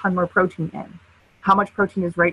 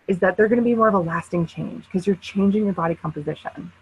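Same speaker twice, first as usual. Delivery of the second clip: ordinary talk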